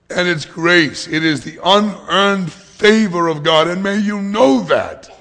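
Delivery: loud, high, slow emphatic proclamation; impassioned preaching. Real, not acted. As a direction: proclaim it to the whole congregation. Preaches with full force.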